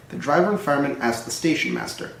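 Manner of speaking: plain reading voice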